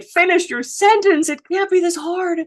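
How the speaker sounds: high-pitched exasperated outburst; losing my mind. Acted. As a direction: drag it out in high, impatient frustration. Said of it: "was losing my mind".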